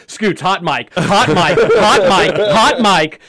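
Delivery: building alarmed hot mic warning; urgent warning. Retold or imitated. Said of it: "I'm like".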